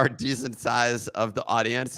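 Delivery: ordinary talk, nothing marked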